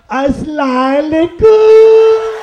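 high, loud nasal auntie, last vowel held long; nasalized Pakistani auntie. Acted. Comic imitates a Pakistani auntie, nasal singsong greeting. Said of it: "the nasalized Pakistani auntie".

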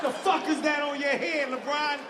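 ordinary talk, nothing marked

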